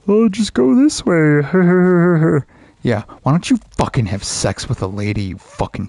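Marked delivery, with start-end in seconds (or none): 0.1-1.4 high, louder sing-song mock; mocking the game. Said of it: "mock quote of the game". 1.5-2.4 short loud exhale laugh; bitter laugh. Real, not acted. Laughs after mock.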